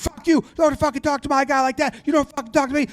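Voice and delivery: high, mock-furious cursing; heated. Yankees coaches coming out hot, high-pitched and heated. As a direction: act out a heated crowd of coaches yelling over each other. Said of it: "They're flying and heated".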